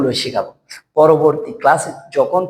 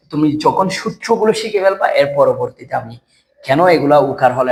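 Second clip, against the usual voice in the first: ordinary talk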